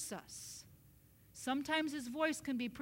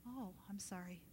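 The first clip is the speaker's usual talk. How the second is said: very soft, low apology; small, hushed sorry; contrite; 5 semitones lower, 12 dB quieter than usual. Retold or imitated. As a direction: apologise meekly under your breath. Acts own contrite reply to God.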